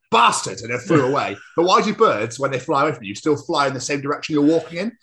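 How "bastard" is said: loud, high mock outburst; indignant bird. Blackbird spotting the walker, indignant yelp of a curse. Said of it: "it's like".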